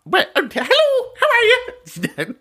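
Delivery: very high, sing-song imitation of mum; mum's phone voice. Mum answering a call from relatives back home, high-pitched phone voice. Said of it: "if my mum picks up the phone".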